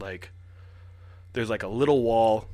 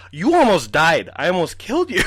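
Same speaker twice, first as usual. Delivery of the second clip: high, loud retold outburst; exasperated yelling; 7 semitones higher, 8 dB louder than usual. Boy scolds grandma who scared him, re-enacted exasperated yell. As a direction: shout it at her in shocked relief.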